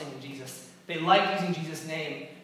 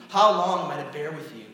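louder exasperated rebuke on HOW; exasperated louder repeat; 10 dB louder than usual. Acted.

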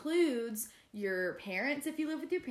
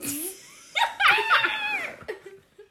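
loud high laugh; 11 dB louder than usual. Real, not acted. Big laugh at retake.

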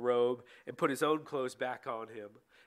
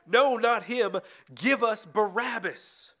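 high, loud crowd cry; crowd shouting; 7 semitones higher, 7 dB louder, slower than usual. A narrator's reading. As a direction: shout it out like an angry mob's demand. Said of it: "They shouted".

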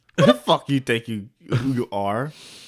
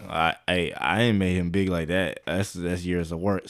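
low, soft, slowing excuse; mock defensive; 7 semitones lower, 9 dB quieter than usual. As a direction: mumble your defense low and quiet. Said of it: "He was like".

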